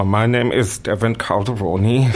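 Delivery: slowed right down, each syllable placed; deliberate, spelled-out pace; slow careful name. Shown as a demonstration. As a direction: say your name slowly for listeners. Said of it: "pronounce it beautifully slowly".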